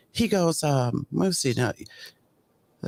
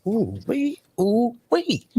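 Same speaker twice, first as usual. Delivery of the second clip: amused disbelief; 6 semitones higher, much slower than usual. Real, not acted. Reacting to a witness's lie.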